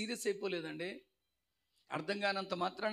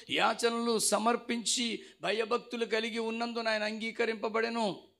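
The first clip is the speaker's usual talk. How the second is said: sustained recitation on a raised tone, slowing at the close; scripture reading; 2 semitones higher, 5 dB louder than usual. Real, not acted. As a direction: intone the verse formally. Reads scripture aloud. (గంభీరమైన వాక్య పఠనం)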